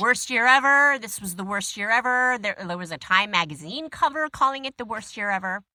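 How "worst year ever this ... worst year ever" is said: mocking complaint. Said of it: "everyone was like".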